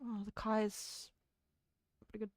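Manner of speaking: soft, tentative broad New Zealand accent; understated put-on Kiwi accent; thicker Kiwi accent. Shown as a demonstration. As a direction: quietly lay on a Kiwi accent. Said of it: "make a Kiwi accent thicker".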